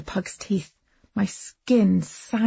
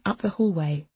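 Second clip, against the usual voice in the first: plain reading voice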